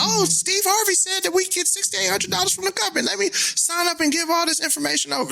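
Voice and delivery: high-pitched gullible mom. Retold or imitated. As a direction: imitate a trusting mom, way up high. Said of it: "So be like".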